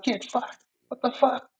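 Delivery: high-pitched mock outrage; retold yell. Junkie shouting back at the photographer, high mock outrage. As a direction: squawk it back like an offended junkie.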